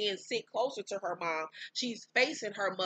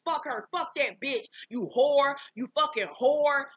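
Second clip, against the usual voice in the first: higher, angry retold insults; heated name-calling, rising in volume; cursing out; 5 semitones higher, 5 dB louder than usual. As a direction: imitate a mother spitting insults, building louder. Mother cursing out daughter in quarrel.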